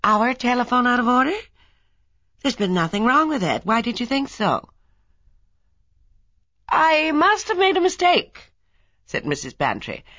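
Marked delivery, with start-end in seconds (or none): 0.0-4.7 much higher, louder astonished question. A narrator's reading. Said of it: "His astonished voice interrupted her". 6.7-8.5 innocent fib. A narrator's reading. Mrs Bantry covering with a fib.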